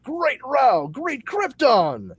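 high, loud, drawn-out superhero cry; hammed-up comic-book astonishment; shouted exclamation. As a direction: proclaim it grandly, stretching each word.

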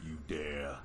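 low, slow menacing god voice; ominous deity voice. Pretend. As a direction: boom it out like a dark lord. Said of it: "You dare summon Sassy Steven".

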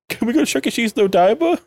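high, pleading kiddie whine; put-on childish whining voice; mock crybaby. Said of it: "such a crybaby here".

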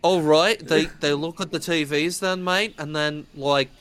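hammed-up, amateur Australian accent; bad Australian accent. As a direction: do an Australian accent you can't really manage. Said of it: "I can't do an Australian accent".

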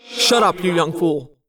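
an elder soldier snapping at a rookie; snapped. Acted. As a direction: cut the young one off sharply. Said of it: "Alaran snapped".

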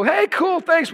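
high, breezy acknowledgement; light imitation of a pleased friend; casual phone reply. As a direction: toss it off like an easygoing friend.

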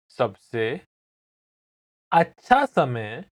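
slow, broken into small phrases; slow repeat. Shown as a demonstration. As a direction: say it piece by piece, pausing long for the listener to repeat. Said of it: "I'm going to say it again slowly".

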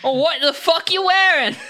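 high, loud put-on Australian accent; hammed-up Aussie guard. Australian guard scolding a parked truck, high and loud Aussie accent. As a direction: bark it like a cartoon Aussie bloke.

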